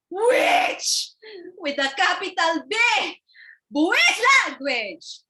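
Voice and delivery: high, loud shouted insult; offended sister shrieking insults; outraged shriek. Acted.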